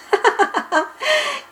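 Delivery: high mischievous giggle; sly giggle. Acted. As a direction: cackle at the fooled friends. Bibi laughs at tricked friends. (petit rire malicieux)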